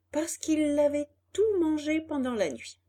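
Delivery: usual speaking voice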